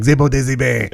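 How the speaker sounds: lilting made-up Creole-style "Zebo Daisy Bay"; made-up phrase. Retold or imitated. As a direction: spell out "Zebo Daisy Bay" with a falling end. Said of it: "it was like".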